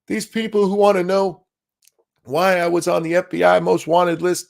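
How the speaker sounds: whiny voice. Retold or imitated. Mocking Ray Epps' whining.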